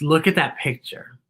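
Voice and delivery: ordinary delivery, nothing marked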